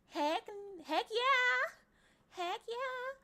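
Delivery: high, whooping enthusiasm; hammed-up cheer. Streamer showing off being informal, high mock cheer. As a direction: whoop it with silly excess.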